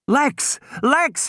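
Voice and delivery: high panicked scream for his sister; high, urgent cry. A narrator's reading. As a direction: yell her name in terror. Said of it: "he was screaming".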